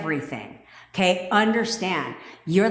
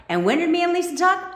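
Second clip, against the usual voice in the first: excited question; 14 semitones higher, 4 dB louder, faster than usual. Real, not acted. Excited recall on the live.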